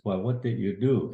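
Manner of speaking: usual speaking voice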